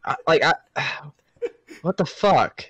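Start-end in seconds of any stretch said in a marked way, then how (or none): none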